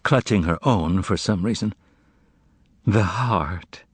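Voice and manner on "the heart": unvoiced hollow whisper. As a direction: breathe it out, stunned and empty. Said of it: "she repeated in a hollow voice".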